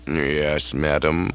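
calm, unruffled butler replies; deferential butler. Acted. Jeeves answering the aunt's questioning.

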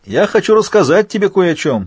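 high, loud taunting shout; shouting villain. A narrator's reading. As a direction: yell it over the rotors, gleeful. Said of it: "прокричал Альфа".

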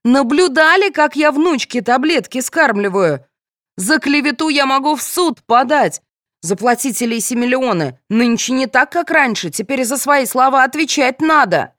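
high-pitched outraged tirade; indignant yelling. A narrator's reading. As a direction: rail at the accuser, voice pitched high. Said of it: "заорала Галина".

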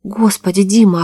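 gasped exclamation. A narrator's reading. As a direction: exclaim in alarmed disbelief. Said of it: "ахнула девушка".

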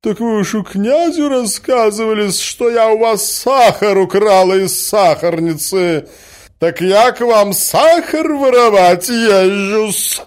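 high, loud scandalized woman; indignant accusation. A narrator's reading. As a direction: confront her, deeply offended. Offended woman confronts Marya Alexandrovna. (возмущённо, высоко и громко)

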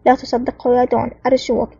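ordinary delivery, nothing marked

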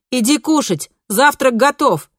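loud, slow call to eat. A narrator's reading. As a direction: call him to breakfast. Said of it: "позвала мама".

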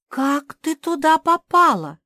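high, loud astonished question; amazed. A narrator's reading. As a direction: ask in utter amazement. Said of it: "изумилась Марсия". (изумлённый возглас)

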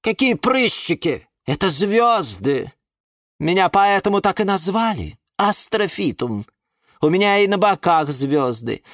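high, proud indignant protest. A narrator's reading. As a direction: correct the insult with wounded pride. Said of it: "возмутился Астра". (возмущённо и гордо)

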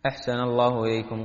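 usual speaking voice

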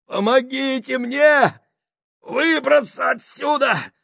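high, slow pleading yell; moaning cry for help. A narrator's reading. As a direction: cry out trapped and hurting. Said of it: "стонал Гослинг".

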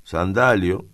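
ordinary delivery

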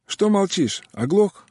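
rude, high-pitched loud jab; boorish. A narrator's reading. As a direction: needle him like a lout. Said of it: "хамовато спросил человек из-за стола".

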